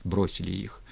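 ordinary delivery, nothing marked

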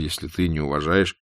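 usual speaking voice

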